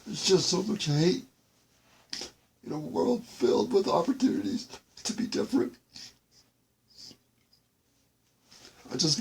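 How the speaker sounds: tearful, high-pitched real crying; high, choked crying voice; breaking down. Real, not acted. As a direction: plead through tears, pausing between sentences. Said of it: "this is making me break down".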